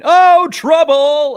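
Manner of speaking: imitated alarmed commentator cry; very high, drawn-out shout of alarm; Benny. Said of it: "the fear of Benny going".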